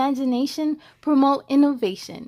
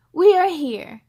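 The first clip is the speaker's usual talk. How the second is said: mild yell; 3 semitones higher, 5 dB louder than usual. Acted. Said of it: "she yelled".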